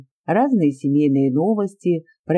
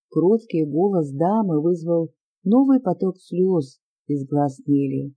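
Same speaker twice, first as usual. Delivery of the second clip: ordinary delivery, nothing marked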